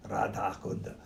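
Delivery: low, soft mock Russian accent. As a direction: drop into a playful Russian accent. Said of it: "That's my Russian voice".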